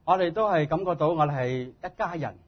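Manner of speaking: ordinary talk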